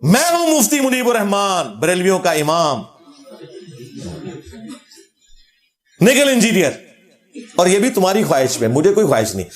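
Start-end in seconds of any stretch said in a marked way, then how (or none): 0.0-7.0 lightly acted proclamation of a rival cleric; Mufti Munib-ur-Rehman. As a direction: step out and declare yourself, mock-heroic. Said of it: "तुम्हारे फिरकों में मुश्किल वक्त आया ना".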